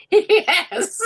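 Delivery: high, giggling admission; laughing yes. Real, not acted. Admits losing her first trade to the host, laughing. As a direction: laugh it out, caught out.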